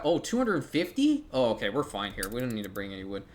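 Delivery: normal talking voice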